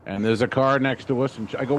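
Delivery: ordinary delivery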